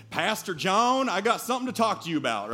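put-on a Southern businessman greeting his pastor, Texas accent. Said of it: "I was gonna try to do the rest of this in that accent".

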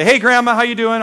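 lifted, upbeat greeting; cheery greeting. Retold or imitated. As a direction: call out a cheery hello down the phone. Phone greeting to grandma, retold.

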